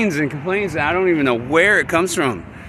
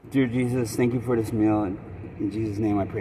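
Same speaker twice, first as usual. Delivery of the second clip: soft, murmured grace before eating; quiet prayer; 6 semitones lower, 8 dB quieter than usual. Real, not acted. Speaker thanking Jesus for the meal, soft prayer. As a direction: say a private thanks, softly.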